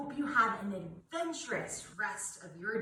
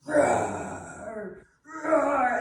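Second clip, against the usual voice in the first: louder, playful growled roar; playful lion roar; 6 dB louder than usual. Shown as a demonstration. Teacher roars for kids, playful and louder. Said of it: "I want you to go".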